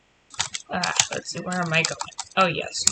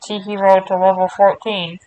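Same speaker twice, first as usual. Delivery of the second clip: louder intercom call through cupped hand; 9 dB louder than usual. Shown as a demonstration. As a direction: announce it into your hand like a PA. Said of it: "That sounded a little better".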